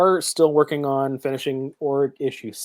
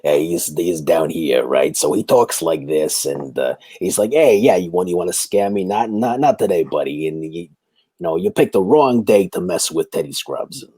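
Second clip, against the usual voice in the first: a lower, gruff New York wise guy brushing off a scammer; Teddy Scrubs New York accent; 7 semitones lower than usual. Shown as a demonstration. A gruff New York accent, playing a tough guy warning off a scammer. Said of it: "like, a, a New York accent".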